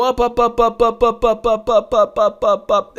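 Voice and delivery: high, even staccato run of ups; rapid repeated ups. Shown as a demonstration. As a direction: tick up the chart in punchy high beats. Mimics chart climbing in steps.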